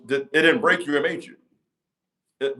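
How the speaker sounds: usual speaking voice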